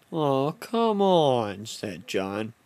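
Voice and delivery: ordinary talk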